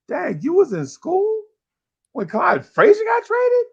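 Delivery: very high, drawn-out teasing disbelief; imitated hecklers, high and incredulous; mock astonishment. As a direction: stretch the question slowly in a squealed mimic. Mocking listeners amazed at his age.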